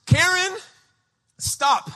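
measured urgent command, word by word; halting orders, starting high and settling. Retold or imitated. Holy Spirit stopping a panicked mother, urgent command. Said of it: "the Holy Spirit just gets a hold".

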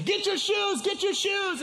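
high, frantic holler; retold panicked yelling. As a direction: yell the wrong order in mock panic. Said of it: "in my panic".

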